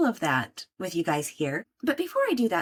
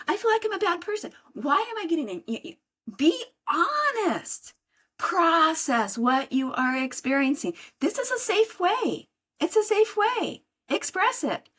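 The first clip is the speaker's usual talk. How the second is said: much higher, heated push to let it out; raised intense urging; 8 semitones higher than usual. Acted. Speaker urging listeners to let anger out, pitched high. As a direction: climb high and urge them to express it.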